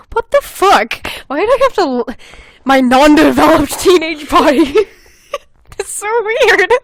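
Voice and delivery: high, loud laughing outburst; cracking up in disbelief. Real, not acted. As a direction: laugh through the cringe. Laughing disbelief at own writing.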